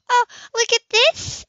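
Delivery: bright delighted exclamation. A narrator's reading. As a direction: gush over a lovely discovery. Anna finds the sketch.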